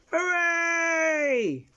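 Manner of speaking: high, loud hooray; cheer. Acted. Cheering the cat twins, loud hooray. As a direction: celebrate the win.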